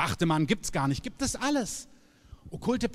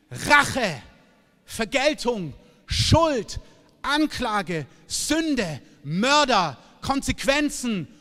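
loud, higher list hammered out word by word; preaching at full force; 4 semitones higher, 4 dB louder, much slower than usual. Real, not acted. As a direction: preach each word like a charge.

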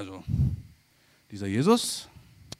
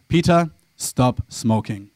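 slow, solemn, lower divine command; voice of God; 5 semitones lower, 5 dB louder, much slower than usual. Pretend. God telling chain-smoking pastor to quit, solemn and slow. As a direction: speak as God giving a slow, weighty order. God speaking audibly to Peter.

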